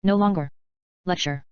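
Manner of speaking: ordinary talk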